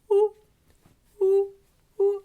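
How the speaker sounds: hooting ooh like an owl; owl hoots. Shown as a demonstration. Leader doing owl faces, hooting ooh. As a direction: round the lips and hoot like an owl. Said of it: "Nice owl".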